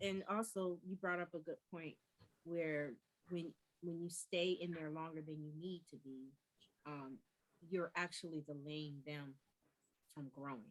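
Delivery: very soft, barely audible; too quiet. Real, not acted. Co-host adding a point about delaying their growth, too quiet. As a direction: make the point far too softly to be heard. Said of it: "Say that a little louder".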